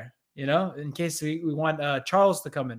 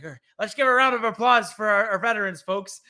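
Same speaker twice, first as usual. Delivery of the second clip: higher, louder flustered MC; nervous host changing the subject; panicking announcer; 5 semitones higher, 5 dB louder than usual. Retold or imitated. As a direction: scramble to calm the crowd. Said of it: "he's panicking".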